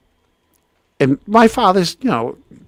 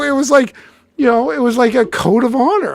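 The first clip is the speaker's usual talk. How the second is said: high-pitched, insistent explaining; pleading justification; 11 semitones higher than usual. Retold or imitated. Player explaining his code to his girlfriend, pleading. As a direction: act out pleading your case.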